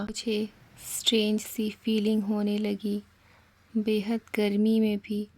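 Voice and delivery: ordinary delivery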